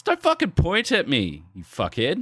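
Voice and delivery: mock outburst, high then dropping; retold angry yelling. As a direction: imitate a hotheaded mate snapping in pitch, not volume. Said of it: "my mate was yelling shit".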